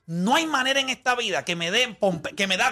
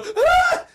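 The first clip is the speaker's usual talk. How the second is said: sudden high laughing yelp of joy; mock joyful cry; 6 dB louder than usual. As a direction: burst out with a happy shriek. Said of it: "simulando el hecho de alegría". (grito de alegría fingido)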